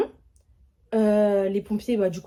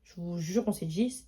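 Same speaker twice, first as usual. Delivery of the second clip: ordinary talk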